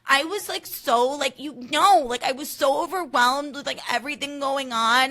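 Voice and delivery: high, loud whiny gush; shrill whiny Amanda. Retold or imitated. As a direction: whine it out, overwhelmed. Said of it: "so shrill".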